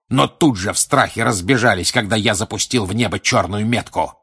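contemptuous tirade. Acted. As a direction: spit contempt at the cowards. Villain rants about cowards. (гневная презрительная тирада)

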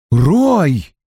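two women stopping him at once; exclaimed. Acted. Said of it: "одновременно воскликнули Синтия и Ким". (восклицание, резко и выше)

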